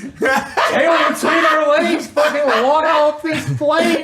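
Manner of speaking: real laughter with a high, loud voice; words spilling out through laughter; laughing hard. Real, not acted. Laughing while telling the walk of shame.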